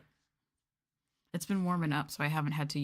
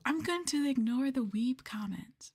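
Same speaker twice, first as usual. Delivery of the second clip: whisper; 7 semitones higher than usual. Acted. Mock-offended aside.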